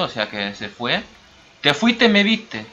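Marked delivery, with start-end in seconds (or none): 1.6-2.7 high, loud, slow mock Argentine; playful Argentine accent, pitched way up. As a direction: draw it out in a hammed-up Argentine accent. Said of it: "Me encanta ese acento".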